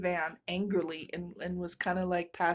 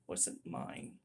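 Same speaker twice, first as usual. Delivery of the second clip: whispered aside; 11 dB quieter than usual. Real, not acted. Aside about the discount.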